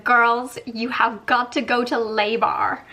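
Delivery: hammed-up American accent; amateur American accent, emphatic and slow; best American accent. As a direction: imitate an American concierge, badly. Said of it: "That's my best American accent".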